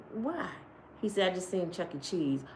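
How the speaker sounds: hushed. Retold or imitated. Hushed report of a rat.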